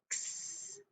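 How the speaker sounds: "x" as a soft voiceless ks; isolated letter sound. Shown as a demonstration. Teacher drilling the last sound of fox, isolated letter sound. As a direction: say "x" as ks with a long hiss, not the letter name. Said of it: "we're gonna say the sound".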